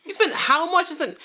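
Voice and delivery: high, loud, laughing disbelief; hammed-up astonishment with laughter; incredulous. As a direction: squawk it back as an outraged question. Echoing disbelief at the tiny improvement.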